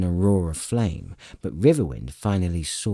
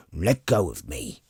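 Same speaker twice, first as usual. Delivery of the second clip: roared furiously. A narrator's reading. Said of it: "Flint roared so furiously".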